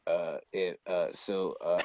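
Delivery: low, halting stammer; stuttering deep-voiced kid. Kid stammering up to his teacher, imitated stutter. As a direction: imitate a boy stuck on his filler words. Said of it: "kinda stuttering ... like, a real deep voice".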